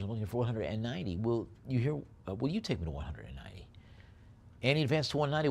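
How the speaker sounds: fast, punchy auctioneer patter; auction bid calling. Acted. Auctioneer to online bidders, brisk bid calling. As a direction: rattle off the bids and knock it down.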